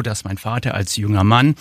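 normal talking voice

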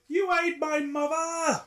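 slow, high, exaggerated London accent; hammy Cockney telling-off. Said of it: "in my worst London accent ever".

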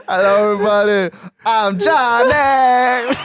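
retelling the Grammy speech as a mock star; Johnny Depp mock. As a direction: ham up the teary star greeting. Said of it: "och säger".

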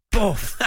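high punchy boff for the crunching tackle; tackle sound effect. Retold or imitated. Ray telling the trial story, high punchy tackle sound. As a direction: smack it out like a hard challenge.

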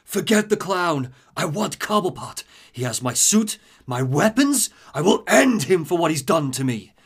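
Mr. Freeze threat. Retold or imitated.

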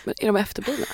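soft unvoiced whisper; whispered joke. Real, not acted. Hosts whisper a cheeky joke about Smålanders. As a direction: whisper it like a naughty secret.

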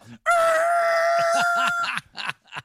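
long, very high loud screech; high screeching noise, held; imitated screech. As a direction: let out a sustained piercing squeal as a sound effect. Imitates the noise after the splat.

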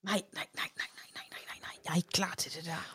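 very soft, whispered rapid no-no-no; hushed mock dread. Real, not acted. Listener not ready for friend's story, in hushed mock dread. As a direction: shrink back, protesting almost under your breath.

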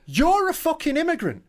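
high, slow exasperated retort. Retold or imitated. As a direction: fire it back, fed up. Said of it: "And it's like".

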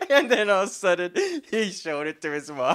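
laughing through the words, loud and high. Real, not acted. Friend retelling his childhood note, laughing hard. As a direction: crack up while telling it.

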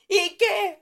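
a high, loud "¿Y qué?"; defiant challenge. Retold or imitated. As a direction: dare him to push it. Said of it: "sí estaba ya muy harta".

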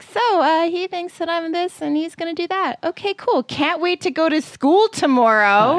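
bitterly sarcastic, high bright mock-cheerfulness; sarcastic mock cheer. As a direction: chirp it like everything is fine when it isn't.

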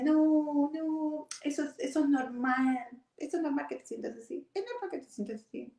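high, soothing mock husband brushing it off; husband's voice. Ex-husband waving off her doubts, high soothing voice. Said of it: "y él me decía".

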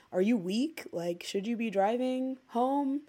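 usual speaking voice

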